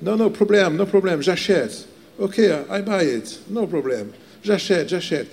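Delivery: mock American accent, French with English mixed in; lightly imitated American accent. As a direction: play the eager American buyer in a light American accent. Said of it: "avec son accent américain".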